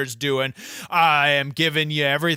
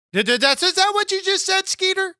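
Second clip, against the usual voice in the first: very high, squeaky outrage; outraged disbelief. Acted. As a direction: shriek it in shocked disbelief. Outraged disbelief at Marco Stunt.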